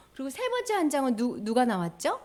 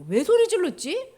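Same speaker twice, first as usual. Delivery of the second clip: ordinary talk, nothing marked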